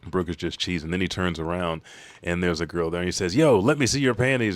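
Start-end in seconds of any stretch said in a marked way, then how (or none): 3.3-4.6 loud, high-pitched cheeky shout; brash demand. Retold or imitated. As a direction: call it out boldly, pitched up. Boy brashly demands of girl.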